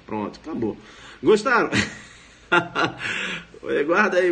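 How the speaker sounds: usual speaking voice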